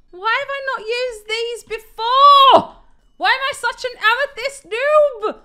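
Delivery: high and loud, teasing herself; excited outburst. Real, not acted. Gamer laughing at herself, high and loud. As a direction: cry out at her own noobness. Mock self-scolding, excited.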